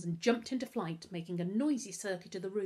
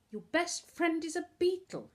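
high, louder incredulous question; scoffing; 11 semitones higher, 5 dB louder than usual. A narrator's reading. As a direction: scoff at an absurd claim. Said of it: "Novak scoffed".

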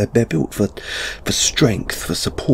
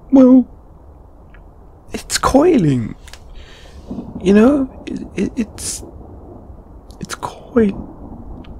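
high, halting, embarrassed stammer; flustered explaining; 8 semitones higher than usual. Acted. Asked what's so bad about coiling, flustered. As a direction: struggle to explain, voice pitched up.

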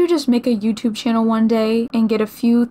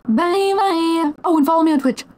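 sing-song sign-off; 7 semitones higher than usual. Real, not acted. Streamer signing off to viewers, high sing-song. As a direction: chirp it in a raised, playful voice.

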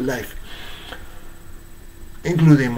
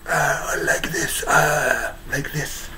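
hoarse, unclear voice; 3 semitones higher, slower than usual. Shown as a demonstration. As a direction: demonstrate a strained hoarse voice. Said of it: "becomes unclear".

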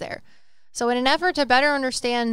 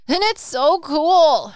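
loud, high, drawn-out enthusiasm; gushing excitement; 7 semitones higher, 10 dB louder, slower than usual. Real, not acted. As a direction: burst out, stretching the words in delight. Gushing over the case evidence.

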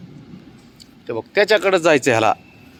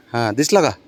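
ordinary talk, nothing marked

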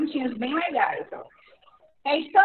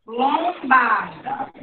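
Lombarda drawn out even more slowly; even slower reading; 3 semitones lower, much slower than usual. Shown as a demonstration. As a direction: stretch Lombarda so they hear the syllables. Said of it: "Ó, só observar". (Lombarda bem mais devagar)

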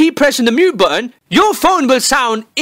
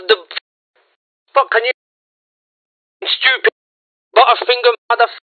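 fake recording mishap; faster than usual. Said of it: "by pretending my phone fucked up".

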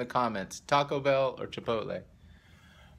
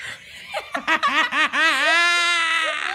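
loud high laughing fit; loud burst of laughter; 8 dB louder than usual. Real, not acted.

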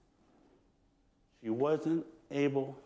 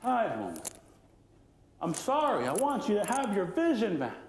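a hint of pleading Raven, pitched higher; 7 semitones higher than usual. A narrator's reading. Raven pleading with Tide Woman to let the tide go. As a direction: coax her earnestly. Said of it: "started to really plead with her".

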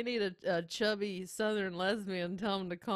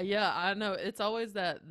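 ordinary talk

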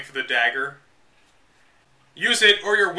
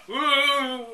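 high mock cheer; excited woo; 9 semitones higher than usual. Real, not acted. Hyping up the Queen Medusa lair, cheering. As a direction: cheer the boss arrival.

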